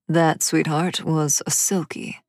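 ordinary delivery, nothing marked

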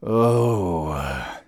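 loud, falling "oh" groan; long groan. A narrator's reading. Undertaker stretching at his desk, groaning. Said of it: "he groaned".

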